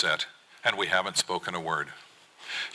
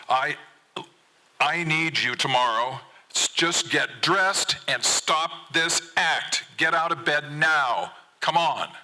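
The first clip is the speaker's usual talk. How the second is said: louder, higher, slow and hammering; angry yell; 6 semitones higher, 3 dB louder, slower than usual. Acted. Dad yelling at son to get out of bed, slow loud anger. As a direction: bark each order to win the fight. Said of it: "I yell back, proving that I can yell louder".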